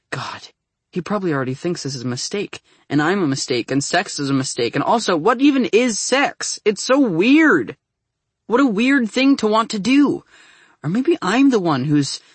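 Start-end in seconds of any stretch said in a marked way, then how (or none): 4.7-10.2 high, loud, frantic disbelief; freaking out. A narrator's reading. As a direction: let the panic boil over. Peak of inner freakout.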